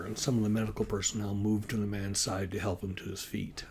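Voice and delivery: ordinary delivery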